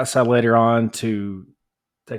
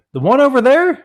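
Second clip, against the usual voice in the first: high, loud outraged question; can't believe it's that neighbor; retelling how he asked the neighbor, in high disbelief; incredulous; 12 semitones higher, 5 dB louder than usual. Retold or imitated. Retold shocked question to neighbor.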